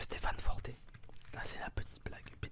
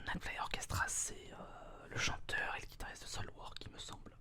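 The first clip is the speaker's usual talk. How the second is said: right in your ear; 8 semitones higher, 10 dB louder than usual. Real, not acted. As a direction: close whisper in the ear.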